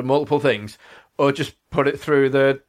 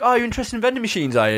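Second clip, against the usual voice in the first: teasing; 9 semitones higher, faster than usual. Retold or imitated. Teasing the marketing lady.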